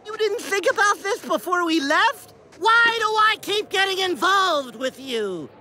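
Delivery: high, incredulous complaint; exasperated. Acted. Traveler learns it needs plugging in, exasperated. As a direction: protest in mounting disbelief. Exasperated at partner's failed plan.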